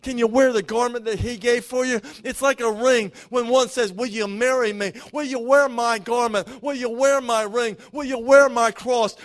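loud, rising preacher's cadence, driving each line home; preaching at full force. Acted. Pastor urging the standing church to receive, preached full force. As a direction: pour it out over the congregation like a revival call.

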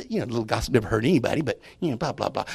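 fast, low, brushed-off excuse; mock dismissive. Preacher mocks people excusing gossip, fast and throwaway. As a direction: rattle off the lame excuse.

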